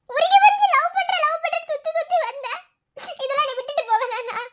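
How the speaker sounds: scolding a foolish friend through tears; tearful anger. Acted. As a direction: rage at him while choking up. Friend scolds after suicide attempt.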